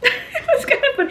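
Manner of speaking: high, laughing through the words; said through real laughter. Real, not acted. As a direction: giggle it out. Laughing while telling.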